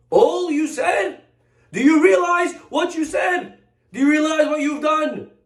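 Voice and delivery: rabbi berating his companion for gossip, outraged shout; furious rebuke. Acted. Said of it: "The Chafetz Chaim was furiated".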